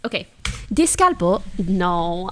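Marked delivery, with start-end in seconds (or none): 0.7-1.4 Spanish word with English vowels; anglicized Spanish, English-sounding vowels; English accent. Real, not acted. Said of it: "I said it so English".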